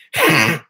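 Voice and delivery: short loud throat honk; demon throat noise. Shown as a demonstration. A trick done since he was a kid, demon throat noise. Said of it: "scaring everybody with a demon voice".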